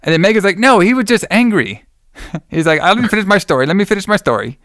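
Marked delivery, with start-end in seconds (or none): 0.6-1.8 higher-pitched imitation of an objection; mock protest. Acted interruption of Herbert's story. 2.8-4.6 high-pitched insistent retort, imitated; mock insistence. Acted protest at being interrupted.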